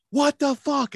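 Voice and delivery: high-pitched retold outburst; imitating a freaked-out reaction; freaking out. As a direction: exclaim it high, as if losing it. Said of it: "I just fucking started freaking out".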